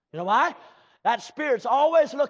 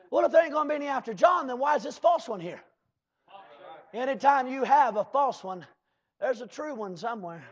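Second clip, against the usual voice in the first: ordinary delivery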